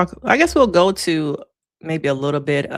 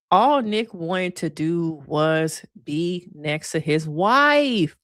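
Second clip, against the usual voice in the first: slow build, "wife" stretched and raised; stress on wife; much slower than usual. Real, not acted. Host stressing Nick is married, heavy emphasis on "wife". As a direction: draw it out, then lean hard on "wife". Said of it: "emphasize the word wife".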